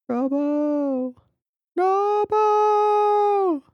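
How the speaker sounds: very high, drawn-out mock wail; acted anguished cry. Commentator imitating the character in the tragic scene, high anguished cry.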